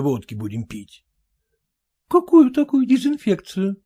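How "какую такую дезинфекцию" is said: high questioning wife voice; puzzled wife. Acted. Wife asking husband about disinfection, high puzzled voice. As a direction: ask it as the baffled wife. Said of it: "спросила она".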